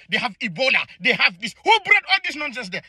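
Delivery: ordinary talk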